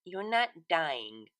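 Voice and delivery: slow, flat-out blunt denial; dry and unimpressed. A narrator's reading. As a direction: cut the drama short. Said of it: "Bon Bon said bluntly".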